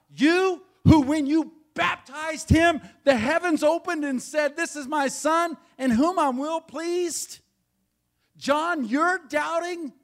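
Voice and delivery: high and loud, hammering every phrase; full-force preaching. Acted. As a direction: shout it out in disbelief at John. Preacher peaks into full-force exhortation.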